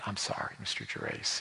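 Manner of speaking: hushed, nearly whispered sorry; a shamed teacher owning up softly; teacher apologising to the father, barely whispered; whispered sheepish apology. Retold or imitated. Ashamed teacher apologising to dad.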